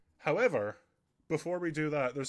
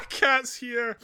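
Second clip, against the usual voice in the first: high delighted squeal; excited; 12 semitones higher, 10 dB louder than usual. Real, not acted. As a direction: burst out laughing at the cat. Cat suddenly shows up on stream.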